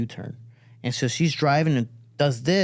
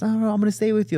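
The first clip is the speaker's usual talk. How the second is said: high-pitched motherly insistence; raised, firm mom protest; insistent mom; 9 semitones higher, much faster than usual. Retold or imitated. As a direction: voice mom refusing to budge. Retold mom insists once more.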